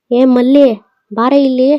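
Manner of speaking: second, sharper shout of Malli. Acted. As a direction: yell across the house, impatient now. Calling her again, more insistent.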